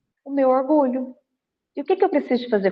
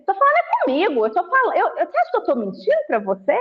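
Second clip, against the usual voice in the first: high, fast offended rant; indignant know-it-all; 6 semitones higher, much faster than usual. Retold or imitated. Imagined arrogant person snapping back at a clerk, high and fast. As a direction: play the touchy person who won't be doubted. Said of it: "Igual aqueles assim".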